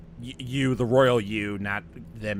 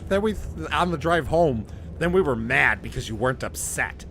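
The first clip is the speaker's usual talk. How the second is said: ordinary talk, nothing marked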